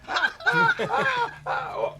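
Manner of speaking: high-pitched silly "oh" cries; silly high noise. Acted. Game master as Blue making pointless cries, silly high noise. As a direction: let out silly high hoots with a laugh. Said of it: "making that silly noise".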